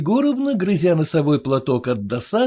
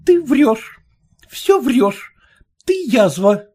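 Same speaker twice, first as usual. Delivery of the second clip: pale with anger; 7 semitones higher, 4 dB louder, slower than usual. A narrator's reading. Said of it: "вся бледная и проговорила".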